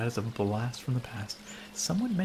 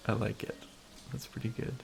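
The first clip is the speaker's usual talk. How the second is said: ordinary delivery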